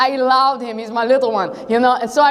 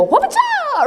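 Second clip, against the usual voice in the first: mock slingshot whoop; 14 semitones higher than usual. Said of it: "you put the rock in there and you go".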